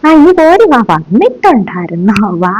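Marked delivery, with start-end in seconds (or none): 0.0-0.8 calling out. Acted. Teacher calls student forward.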